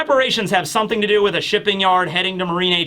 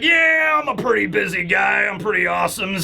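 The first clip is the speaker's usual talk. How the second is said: boastful Blackbeard; 2 semitones higher than usual. Retold or imitated. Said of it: "Blackbeard gets up and he's like".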